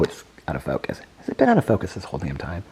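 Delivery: ordinary talk, nothing marked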